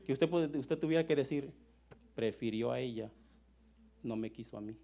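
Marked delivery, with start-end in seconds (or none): 2.1-4.8 low, subdued hurt; sad rejection. Acted. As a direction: voice a rejected partner, quietly wounded. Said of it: "¿Se siente bonito o se siente". (con tristeza, en voz baja y dolida)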